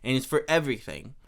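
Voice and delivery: ordinary delivery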